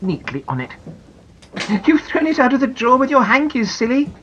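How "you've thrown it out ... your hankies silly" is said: higher, fond scolding mum; mother's voice. Acted. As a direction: tease her for being silly. Mummy scolds Janet.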